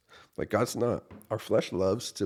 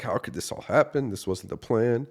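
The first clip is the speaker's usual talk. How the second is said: higher and louder, crying out to God; retold yelling at God; 5 semitones higher, 6 dB louder than usual. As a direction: yell it up at God in frustration. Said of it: "I'm just yelling at the Lord".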